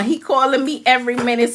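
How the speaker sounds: ordinary talk, nothing marked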